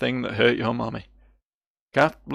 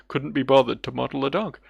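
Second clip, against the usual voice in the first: ordinary talk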